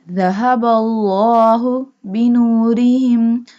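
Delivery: "الله" chanted with a heavy, full l; bold Allah. Shown as a demonstration. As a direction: chant "الله" boldly with a long vowel. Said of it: "So we bold it". ("الله" with thick rounded l in recitation)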